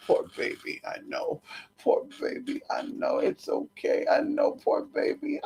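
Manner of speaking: hushed, soothing whisper to a pet; soft whisper. Real, not acted. Owner whispering to her frightened pet, hushed and soothing.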